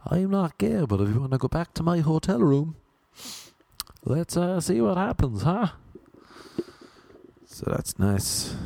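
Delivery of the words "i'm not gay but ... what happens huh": imitated propositioning guy, pitched high; mock come-on. Guy invites comic back to his hotel room, mock high-pitched come-on. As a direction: put on a high, coaxing man's voice. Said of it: "with a guy telling me".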